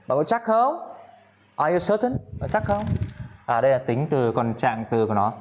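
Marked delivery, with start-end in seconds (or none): 0.1-0.9 incredulous. Acted. Doubting a lottery winner. 1.5-2.8 incredulous. Acted. Doubting a lottery winner.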